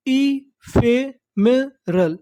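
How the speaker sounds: "ephemeral" very slowly, each syllable set apart; broken into syllables. Shown as a demonstration. Teacher assembling the word for learners, syllable by syllable. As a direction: sound out "ephemeral" one beat at a time. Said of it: "टुकड़ों में तोड़-तोड़ के पढ़ना है".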